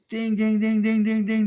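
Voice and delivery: high sung bell imitation; boxing bell ding. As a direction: ring the fight bell with your voice. Mock boxing match bell.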